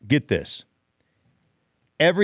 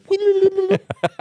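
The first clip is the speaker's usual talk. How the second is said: a sudden high squealing laugh; 3 dB louder than usual. Real, not acted. Host cracking up at his own joke, squealing laugh. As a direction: burst out in a high-pitched giggle.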